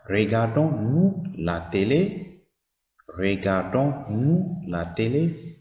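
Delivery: "Regardons-nous la télé?" slowly, rising on "télé"; slow question rise. Shown as a demonstration. Teacher models the inverted question, rising on "télé". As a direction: draw out "télé" upward. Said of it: "It will become".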